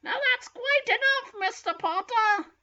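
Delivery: high-pitched scolding schoolteacher; Professor McGonagall. Acted. Teacher reprimanding Mister Potter, high scolding voice. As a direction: cut the pupil off in a high, stern voice. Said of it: "said racist Professor McGonagall".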